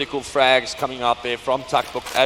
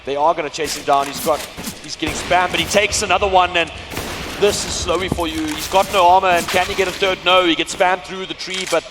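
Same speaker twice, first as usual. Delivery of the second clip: esports caster; 5 semitones higher, 5 dB louder than usual. Acted. Live play-by-play, rising excitement.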